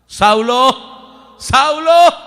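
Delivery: a loud, booming call from the sky; divine call from heaven. Acted. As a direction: call his name like God from above. Voice of God calling Saul.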